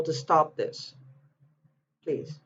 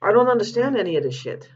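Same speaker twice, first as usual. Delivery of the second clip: real frustration breaking through, higher and louder; exasperated outburst, pitched up; getting upset; 6 semitones higher, 4 dB louder than usual. Real, not acted. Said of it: "I'm just getting upset".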